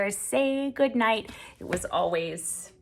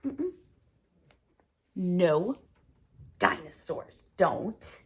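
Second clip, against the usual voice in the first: ordinary delivery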